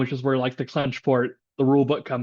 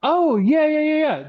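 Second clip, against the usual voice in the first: excited; 13 semitones higher, 5 dB louder than usual. Real, not acted. Excited recall of story.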